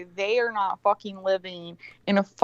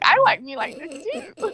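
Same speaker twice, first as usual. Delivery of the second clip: a lightly voiced giddy reply, much higher; happy; 10 semitones higher than usual. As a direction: chirp it back, pleased with herself. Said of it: "I was very happy".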